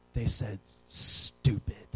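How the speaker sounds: low, slow near-whisper; hushed reveal. Retold or imitated. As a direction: stretch out the forbidden word in secret. Child reveals the secret word.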